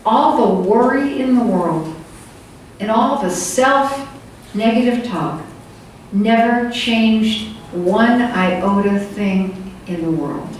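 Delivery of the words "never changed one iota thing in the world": spelled out slowly, word by word; slow down to land the point; deliberate slow drive-home; slow emphasis. Real, not acted. Driving home that worry changes nothing.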